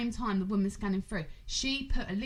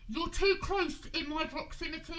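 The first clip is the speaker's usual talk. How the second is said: snooty woman; 7 semitones higher than usual. Retold or imitated. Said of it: "I was like".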